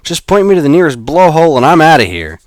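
high, loud snapped refusal. Acted. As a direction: bark out a flat no. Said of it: "Alex snapped".

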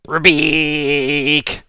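high loud frog-monster bellow; monster roar. Acted.

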